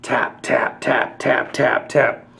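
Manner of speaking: soft breathy tap tap; quiet tapping. Acted. Storyteller making the crawly creeps' taps, softly.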